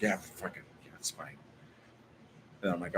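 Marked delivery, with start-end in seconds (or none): 0.0-1.4 mumbled and much softer, low and sulky; imitating a deflated grumble; grumbling neighbor. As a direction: mutter it like she's annoyed and put out. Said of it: "She's like".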